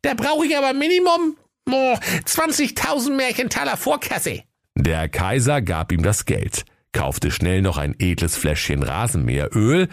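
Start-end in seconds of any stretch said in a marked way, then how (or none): 0.0-4.4 very high shopkeeper voice; name your price in a high, sly voice; crafty Dennis Höke. Acted. Höke demands advance payment from Kaiser, high and crafty. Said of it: "Der listige Dennis Höke".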